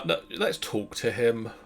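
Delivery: ordinary delivery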